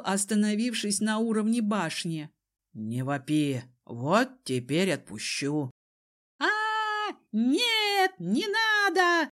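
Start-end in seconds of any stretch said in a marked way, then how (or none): 2.7-5.7 low, controlled man's voice; menacing Desmond. A narrator's reading. Desmond threatens to drop the screaming cousin, low and menacing. 6.4-9.3 very high, wailing plea; terrified cry. A narrator's reading. Cousin dangles in the air, crying out in terror. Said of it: "кричал кузен с вытаращенными глазами".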